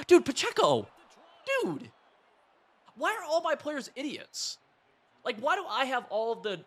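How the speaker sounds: high, loud outburst at his player; real frustrated yelling; exasperated shout. Real, not acted. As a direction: yell in disbelief at the blunder.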